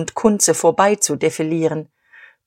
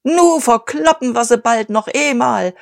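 put-on shrieking old veteran; 6 semitones higher than usual. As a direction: screech it waving a cigar. (kreischender alter Veteran)

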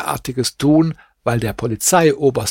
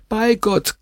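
much higher, begging; second oath pitched far higher; desperate plea; 10 semitones higher than usual. Acted. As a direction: push the repeated oath up high. Barber repeats the oath, higher.

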